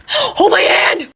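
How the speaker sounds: high, drawn-out panicky plea; scared. Real, not acted. Storyteller to viewers mid-scare, panicky. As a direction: cry it out like you need someone to cling to.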